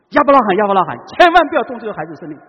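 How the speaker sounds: loud, urgent cry to stop him; a loud, urgent angel's call to stop Abraham; urgent call from heaven. Acted.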